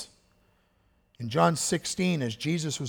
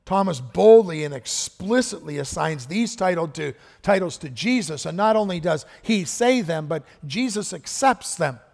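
ordinary talk

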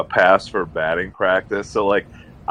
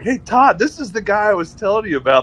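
higher and louder, laughing, showing him off; hearty introduction; 9 semitones higher, 4 dB louder than usual. Retold or imitated. Uncle introducing nephew to Todd, hearty and laughing. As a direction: present the fan to the player with a grin. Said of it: "He's like".

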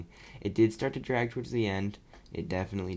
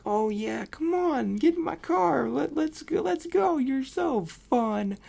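much higher, giddy and eager, slowing on the last line; mock gushing; 3 dB louder, slower than usual. Teen mocking how she would NOT greet the creepy stranger, squealing high. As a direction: ham up a starstruck fan begging him into the car. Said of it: "I would not be like".

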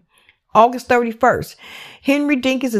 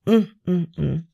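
usual speaking voice

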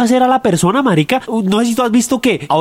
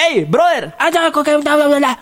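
mock street guy; 6 semitones higher than usual. Mimics a man in a car.

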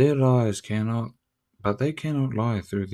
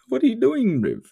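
much higher, louder squeal with a laugh; squeaky mock taunt; 14 semitones higher, 5 dB louder than usual. Laughing at someone lost in the conversation, squeaky mock taunt.

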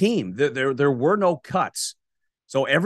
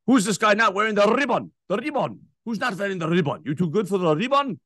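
much higher, indignant put-on accent; mock Seinfeld character; 6 semitones higher than usual. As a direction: needle him about the missing ribbon. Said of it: "that individual is like".

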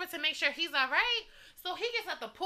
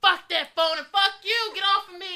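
loud mock drunken fury; reenacting a belligerent brush-off; imitated angry outburst; 4 semitones higher, 8 dB louder than usual. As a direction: push each curse out hard and loud. Voicing boyfriend's angry outburst.

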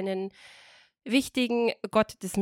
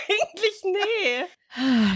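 slow, high, tearful laughing voice; crying with laughter; 11 semitones higher, 4 dB louder, much slower than usual. Real, not acted. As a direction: sob out words from laughing.

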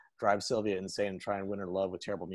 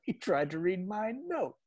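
Emo Phillips voice; 8 semitones higher than usual. Retold or imitated. Lance gloating about the note, high comic whine. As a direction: whine it out, pleased with yourself. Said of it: "the emo Phillips voice".

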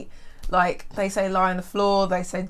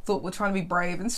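ordinary talk, nothing marked

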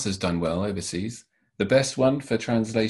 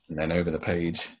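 ordinary delivery

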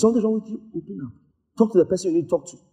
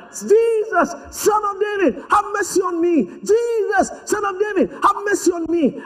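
high, drawn-out plea; desperate shouting; 13 semitones higher, slower than usual. Acted. Blind man shouting after Jesus for mercy, high insistent cry. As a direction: keep crying out at the top of the range. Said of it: "he was shouting".

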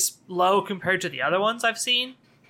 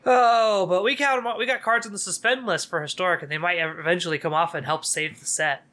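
sarcastic. Acted. Co-host feigns hope that suspended cards will save the set, sarcastic. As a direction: lay the sarcasm on thick. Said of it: "if you couldn't get the sarcasm there".

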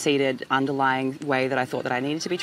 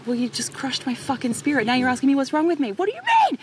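retold exasperated outburst; 10 semitones higher than usual. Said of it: "And I'm like".

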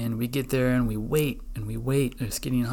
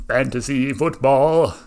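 loud, drawn-out title call; segment announcement; 8 dB louder, slower than usual. Pretend. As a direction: announce it big, stretching the words.

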